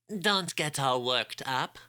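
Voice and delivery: put-on calm them slowly in a nasal French accent. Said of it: "Neil Shemaine spoke up".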